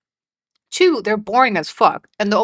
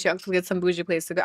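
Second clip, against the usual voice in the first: ordinary talk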